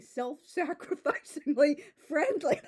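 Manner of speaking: breaks into laughter mid-word; laughing. Real, not acted. Reviewer laughing at the book's line, laughing. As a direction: laugh through the silly word.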